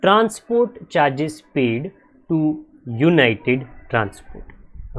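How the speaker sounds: very slow, word-by-word dictation. Shown as a demonstration. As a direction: spell the narration out slowly for the class. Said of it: "Narration is". (धीरे-धीरे लिखवाते हुए)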